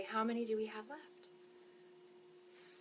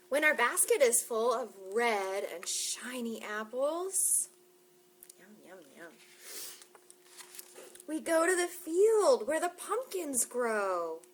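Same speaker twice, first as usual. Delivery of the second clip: bright, slow storybook voice; reading to children; 2 semitones higher, 6 dB louder, slower than usual. Acted. Librarian reading a picture book to children, bright and slow. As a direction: read it slowly to the little ones.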